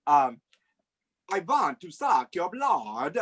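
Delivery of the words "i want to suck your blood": put-on slow, swooping Transylvanian vampire accent. Guest gives clue in guessing game, slow mock Transylvanian accent. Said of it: "Oh, Transylvania".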